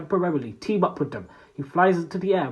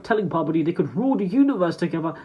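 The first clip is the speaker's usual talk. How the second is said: usual speaking voice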